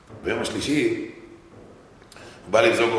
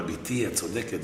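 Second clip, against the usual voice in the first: much softer, slow and gentle; soft fatherly reply; 5 semitones lower, 8 dB quieter, slower than usual. Retold or imitated. Father quietly tells his daughter she is right, soft and slow. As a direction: concede gently to your daughter.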